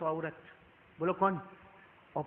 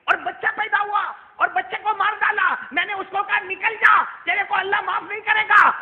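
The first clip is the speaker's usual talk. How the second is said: high, indignant shouting; heated full-voice denunciation; angry report; 12 semitones higher, 9 dB louder than usual. Retold or imitated. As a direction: recount the sin in outrage, building to a yell. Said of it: "ابو ہریرہ غصے میں کہنے لگے".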